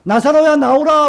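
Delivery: high, loud summons into the tomb; commanding call. Acted. Jesus calling Lazarus out of the tomb, loud and high. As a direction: call the dead man out with authority. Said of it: "나사로를 우리 주님께서".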